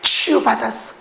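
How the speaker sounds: ordinary talk, nothing marked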